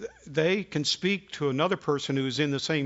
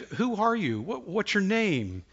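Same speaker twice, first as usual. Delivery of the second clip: urgent worried questions; 7 semitones higher than usual. Retold or imitated. Urgent questions to scared caller.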